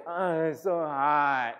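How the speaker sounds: long, drawn-out whine; exaggerated groan of a man wilting in heat; mock complaining. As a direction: moan it very slowly, hammed-up. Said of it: "Jonah's complaining again".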